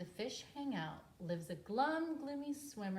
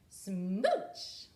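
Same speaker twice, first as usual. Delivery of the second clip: loud smooch; 9 dB louder than usual. Acted. Final kiss.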